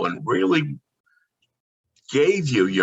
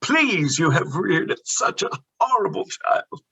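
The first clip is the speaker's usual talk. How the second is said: much higher, mock-polite scolding; prim English shopkeeper; 10 semitones higher, slower than usual. To the mother of a pestering child, high prim rebuke. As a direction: primly tell off the mother in character. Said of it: "I'll say".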